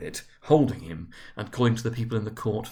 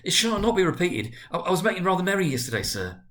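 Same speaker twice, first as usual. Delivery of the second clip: higher and fast, stumbling over the excuse; pleading; 8 semitones higher than usual. Acted. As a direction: hurry through a nervous apology. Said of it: "pleaded Bob".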